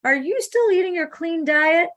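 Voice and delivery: high-pitched imitation of a mom checking up; retold motherly check-up, pitched well up; questioning mother. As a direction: call the son's name high, then quiz him. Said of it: "she said".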